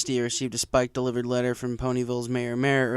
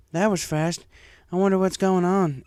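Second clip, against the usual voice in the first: lightly voiced Applejack; 7 semitones higher, 4 dB louder than usual. A narrator's reading.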